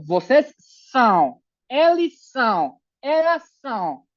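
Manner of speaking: each "são" drawn out as a nasal ow. Shown as a demonstration. Teacher drilling verb forms, nasal "são". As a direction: slowly let "são" buzz in the nose. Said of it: "Siente cómo tu nariz vibra".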